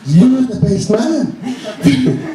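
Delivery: drawn-out high teasing cheer, laughing; mock cheer. Drunk airport friends hailing the best man, drawn-out mock cheer. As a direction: ham up the strangers' cheer, slow and high.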